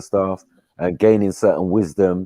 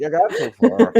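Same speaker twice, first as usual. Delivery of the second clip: high laughing mock Irish accent; playful mock Irish accent; Irish accent attempt; 11 semitones higher than usual. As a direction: squeak it out in a jokey Irish accent.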